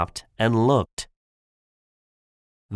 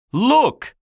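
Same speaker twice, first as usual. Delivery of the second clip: high, loud shouted command; 12 semitones higher, 5 dB louder than usual. Acted. Shen shows Po his cannons, shouting. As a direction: shout it sharply, pitched way up. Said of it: "shouted Shen".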